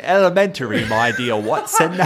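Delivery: high, theatrical British Sherlock; put-on British accent. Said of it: "she puts on this British".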